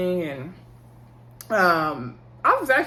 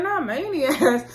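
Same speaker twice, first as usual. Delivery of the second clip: gentle correction; 7 semitones higher, 3 dB louder, slower than usual. Retold or imitated. Mom correcting the boy.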